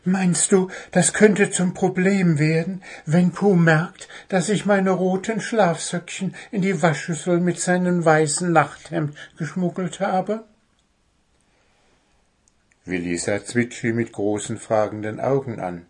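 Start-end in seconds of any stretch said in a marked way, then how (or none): 0.0-10.4 high-voiced little bird, all aflutter; excited little bird asking a worried question; excited twittering. A narrator's reading. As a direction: chirp it anxiously in a high voice. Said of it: "zwitscherte aufgeregt".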